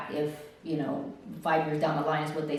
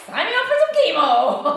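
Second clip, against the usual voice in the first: high, loud outburst; comic eager shout; 12 semitones higher, 7 dB louder than usual. Retold or imitated. Patient changes her mind on chemo, eager shout. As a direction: belt it out like an eager volunteer. Abrupt turnaround after dire prognosis.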